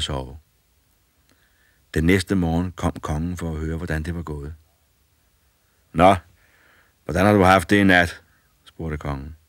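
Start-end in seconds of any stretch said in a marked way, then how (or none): 5.9-8.2 louder kingly question; king checks on the boy; king asking. A narrator's reading. King asks the boy about the night, louder.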